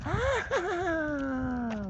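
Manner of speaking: high, wavering screech dying away; witch's flying shriek. A narrator's reading. He hears her flying by, high wailing screech. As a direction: shriek as if swooping past. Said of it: "you can hear her flying by".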